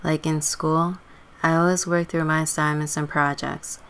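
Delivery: ordinary delivery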